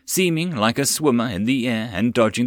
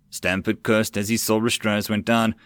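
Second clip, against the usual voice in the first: plain reading voice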